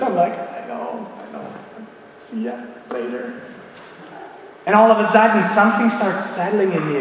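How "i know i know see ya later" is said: very soft, half-whispered grudging reply; muttered brush-off. Retold or imitated. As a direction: mumble it low as if slinking away. Acts brushing off wife's advice.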